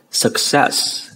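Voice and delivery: "success" nearly voiceless with thin s sounds; light S sound. Shown as a demonstration. Teacher repeats the model word, light S. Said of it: "nhẹ, phải không ạ?".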